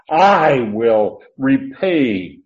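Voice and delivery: long, loud stress on "I"; emphatic pronoun. Shown as a demonstration. Teacher showing Paul's promise to repay, emphatic pronoun. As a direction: punch a drawn-out "I", then slow, deliberate words. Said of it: "another emphatic pronoun".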